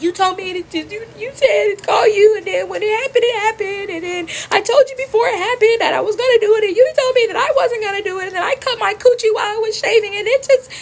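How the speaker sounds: high, wailing acted explanation; frantic explaining. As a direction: plead your case in a tearful high voice. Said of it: "I'm trying to explain".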